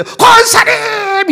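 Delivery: loud, high, drawn-out greeting call; joyful call. Retold or imitated. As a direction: call out to the deaconess across the lot, thrilled. Joyfully calling out to deaconess.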